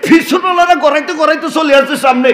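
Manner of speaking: high, driving sermon chant; impassioned preaching. Real, not acted.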